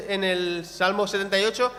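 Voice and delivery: ordinary talk, nothing marked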